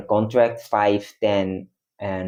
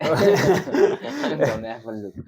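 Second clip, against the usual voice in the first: laughing; 7 semitones higher, slower than usual. Real, not acted. Laughing at the wet cat.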